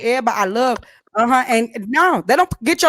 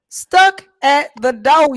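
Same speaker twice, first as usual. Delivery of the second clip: slow repeat; 2 semitones higher, 3 dB louder, much slower than usual. Acted. Repeats punchline slowly.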